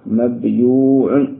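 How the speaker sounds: مبيوع drawn out slowly, each sound clear; slow demonstration. Shown as a demonstration. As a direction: spell out مبيوع slowly for the class. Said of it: "إذا أصلها ماذا؟". (نطق مبيوع ببطء شديد)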